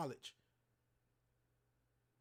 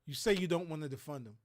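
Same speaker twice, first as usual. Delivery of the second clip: usual speaking voice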